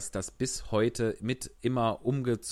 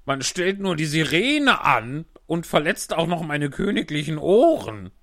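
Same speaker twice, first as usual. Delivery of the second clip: grumbling king; 4 semitones higher, 7 dB louder than usual. Acted. King complains about siren.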